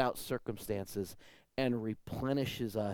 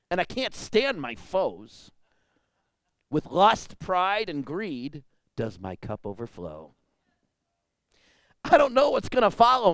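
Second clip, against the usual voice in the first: loud, high preacher proclaiming; fervent pulpit declamation; full-force preaching; 5 semitones higher, 6 dB louder than usual. Acted. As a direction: cry it out from the pulpit, higher and louder. Reverse psalm recited in preaching.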